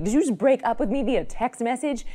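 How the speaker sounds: can't believe he dumped you by text; a hammed-up cry of indignation; outraged disbelief.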